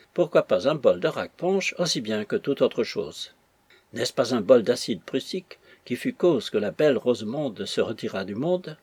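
ordinary delivery

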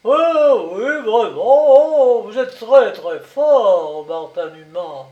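loud, high mocking exclamation; laughing it off loudly as he shakes his head; mocking cry. A narrator's reading. Commandant taunting Martin Dumas, loud mocking cry. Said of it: "Le commandant s'écria".

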